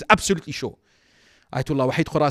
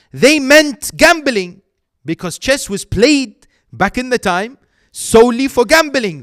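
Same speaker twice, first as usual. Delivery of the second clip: raised, pitched-up sermon delivery; preaching at full force; 9 semitones higher, 9 dB louder, slower than usual. Acted. Preacher voices the young questioners, loud and emphatic. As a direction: press the youths' question on the hall.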